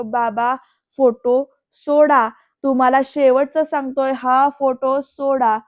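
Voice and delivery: loud, acted final demand, slowing at the end; shouted warning. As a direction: punch out an angry last warning. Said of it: "असं म्हणत ओरडून बोलतोय".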